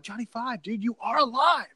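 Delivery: high-pitched, lightly hammed-up awe; mock amazement. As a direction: play an awestruck movie fan, voice lifted. Voicing viewer's amazed reaction to robot.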